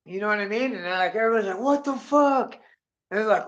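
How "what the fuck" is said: high-pitched retold disbelief; mock bewilderment. Storyteller voicing everybody's reaction, high bewildered exclamation. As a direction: exclaim like a baffled bystander.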